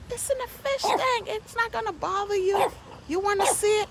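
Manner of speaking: high, put-on neighbor voice; mimicking neighbor. The neighbor offering to show the snake, high mimicking voice. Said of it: "She was like".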